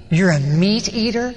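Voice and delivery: slow, disbelieving question; mock incredulity, stretching the words; incredulous question. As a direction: act out appalled disbelief, drawn out. Krishna reacts to meat confession.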